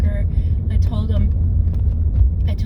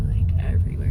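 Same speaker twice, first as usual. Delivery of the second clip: whisper; faster than usual. Real, not acted. Slobbering from nerves, whispered.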